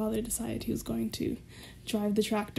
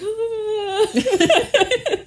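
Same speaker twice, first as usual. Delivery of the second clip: high laughing wail; teary half-laughing ah; mock crying wail; 12 semitones higher, 8 dB louder than usual. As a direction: cry out between laughter. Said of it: "And I'm like".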